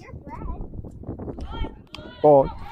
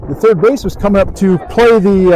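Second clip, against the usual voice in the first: high, loud live call; excited play-by-play; 7 semitones higher, 8 dB louder than usual. Real, not acted. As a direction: call the ball getting through as it happens.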